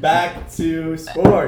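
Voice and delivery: slow, loud whiny drawl; mock bored boy. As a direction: drag it out like a bored guy. Mocking boys changing subject.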